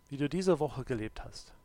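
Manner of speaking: usual speaking voice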